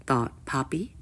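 plain reading voice

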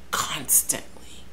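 breathy whispered aside. Real, not acted. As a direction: drop to a slow whisper. Weary aside about helicopters.

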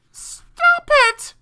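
very high, loud shriek; shrill acted scream. A narrator's reading. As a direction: scream it as the high-voiced spider. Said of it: "she screamed".

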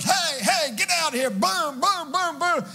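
put-on father shouting at wolves to protect kids, big high holler. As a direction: yell it out from the porch to scare them off. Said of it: "That daddy steps out there and hollers".

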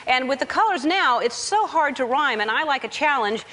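usual speaking voice

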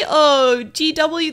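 usual speaking voice

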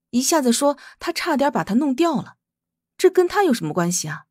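plain reading voice

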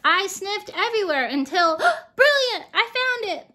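brilliant, found it at last; excited discovery. Acted. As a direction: gasp then a high, loud cry of triumph. Finding the myrtle at last.

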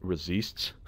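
quiet, questioning French-accented word; accidental French accent. Real, not acted. Gamer puzzled by his own slip into a French accent. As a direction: try the word softly with a French tinge. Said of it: "just turned French there for a second".

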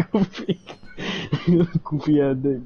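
giggling through the words; laughing. Real, not acted. Laughing at insult names.

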